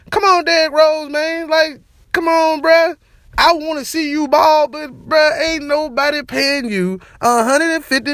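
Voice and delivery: high exasperated plea. Real, not acted. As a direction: beg him to face facts. Pleading with Derrick Rose.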